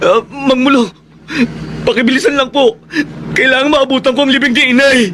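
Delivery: grieving son begging the driver to hurry; tearful plea. Acted. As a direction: plead through tears. Desperate to reach mother's funeral.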